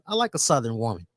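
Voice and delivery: ordinary talk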